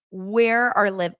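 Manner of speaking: usual speaking voice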